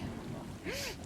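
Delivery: high delighted gasp; a soft squeaky gasp at a cute cat; sharp gasp. Real, not acted. Sees a cat on screen.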